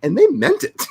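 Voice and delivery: laughing through the words; giggling as you say it; amused laugh on the words. Real, not acted.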